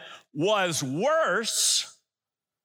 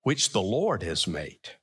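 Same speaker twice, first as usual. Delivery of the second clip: low, deliberate "Lord" standing out; stress on LORD; 10 semitones lower than usual. Shown as a demonstration. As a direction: drop low and lean on "Lord". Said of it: "What's, what's the part that ought to be?".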